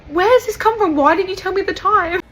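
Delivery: high-pitched mock disbelief; exaggerated high-voiced bafflement; incredulous questioning. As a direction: pitch it way up, puzzled and protesting. Acts out a belated reaction to praise.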